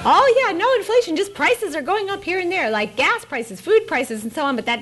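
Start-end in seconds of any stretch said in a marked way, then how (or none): 0.1-2.7 sarcastic mock denial. Mocking officials' denial of inflation.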